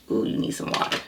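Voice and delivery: soft unvoiced whisper; whispered aside. Real, not acted. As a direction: whisper it to yourself. Aside after clearing throat.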